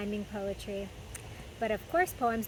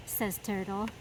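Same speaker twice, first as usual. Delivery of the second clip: put-on lively read-aloud for little kids; storybook reading. A narrator's reading. Host reading a picture book to children, animated storytime voice. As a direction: read it like story time in the garden.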